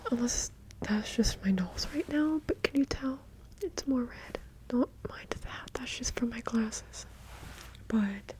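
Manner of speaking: close, unvoiced whispered chat; ASMR whisper. Real, not acted. ASMR creator chatting to viewers in a whisper. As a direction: whisper intimately into the mic.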